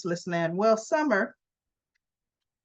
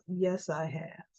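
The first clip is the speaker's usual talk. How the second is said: flat admission; 4 semitones lower, 8 dB quieter than usual. Retold or imitated. Blunt confession to Summer.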